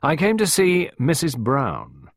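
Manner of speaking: "Missus Brown" slowed and weighted; emphasis on Brown. A narrator's reading. Costello saying whom he visits, stressing "Missus Brown". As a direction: lean deliberately on the name "Missus Brown". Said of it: "gave the name a certain emphasis".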